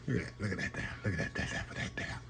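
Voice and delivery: breathy hushed whisper over the food; an amazed whisper, voice dropping away; awed whisper. Real, not acted. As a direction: whisper it like you can't believe the sight.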